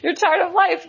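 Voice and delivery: very high, laughter-filled hammed-up exclamations; laughing mock disbelief. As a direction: play up the absurdity, laughing as you go. Joking about never leaving Paris.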